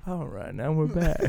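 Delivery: mock composed DJ voice; sixties radio DJ. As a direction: snap back to on-air patter. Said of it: "some radio D J back in".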